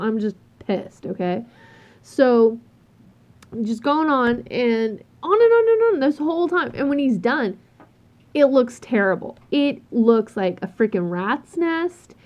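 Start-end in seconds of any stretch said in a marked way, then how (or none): none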